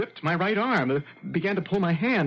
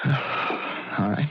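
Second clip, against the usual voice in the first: resigned sigh; 3 semitones lower than usual. Acted. Pianist gives in to Lottie.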